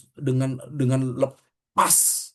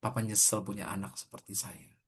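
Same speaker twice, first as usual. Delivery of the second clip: much softer, wounded retelling of the father's words; son repeating it hurt; 5 semitones lower, 10 dB quieter than usual. Retold or imitated. Son tells the pastor what his father said, hushed and hurt. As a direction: say it small, like it still stings. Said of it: "Papa saya bilang".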